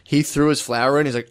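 ordinary talk

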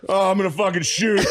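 high, hammed-up moan rising; mock climax cry. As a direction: overact the climax. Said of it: "have everyone like".

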